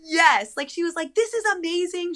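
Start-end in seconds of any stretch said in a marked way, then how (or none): 1.1-2.1 ecstatic. Retold or imitated. Lash lady thrilled that my water broke, squealing with delight. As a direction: gush like she's thrilled for you. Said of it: "like, ecstatic".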